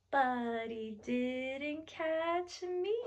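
soft, very slow singsong punchline; playful gloating. Acted. Telling children the turtle missed her, playful gloating. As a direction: stretch each word out softly.

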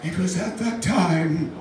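slow British documentary narration; solemn British accent, drawn out with long pauses; British narrator. Acted. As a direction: narrate the hunt slowly like a British naturalist. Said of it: "this British dude's like".

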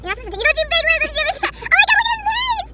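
loud, high outburst climbing to a shriek; excited squeal. Real, not acted. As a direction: build to a piercing fangirl squeal. Teased, then spots favorite member.

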